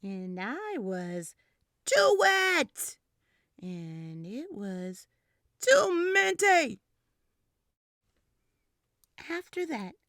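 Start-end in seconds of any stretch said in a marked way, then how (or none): 1.9-3.0 whiny shout. Acted. Toddler complaining loudly. 5.6-6.8 whiny shout. Acted. Toddler complaining loudly.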